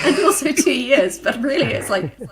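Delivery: laughing. Real, not acted. Joking correction while laughing.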